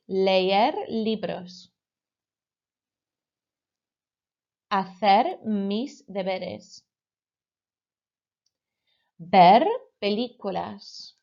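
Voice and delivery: slow, clear model of infinitives; slow model phrase. Shown as a demonstration. Teacher slowly modelling infinitives for pupils to repeat. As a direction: draw the phrase out slowly for echoing. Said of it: "repeat after me".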